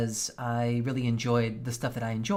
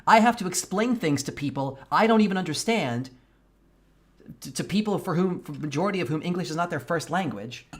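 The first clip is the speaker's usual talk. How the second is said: louder, higher venting about work; frustrated rant; 4 semitones higher, 4 dB louder than usual. Real, not acted. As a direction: vent the frustration, voice climbing. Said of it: "I am so fucking pissed".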